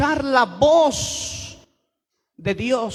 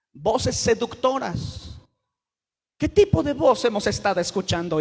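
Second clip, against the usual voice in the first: usual speaking voice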